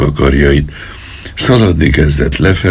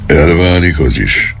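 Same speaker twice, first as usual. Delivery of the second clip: ordinary delivery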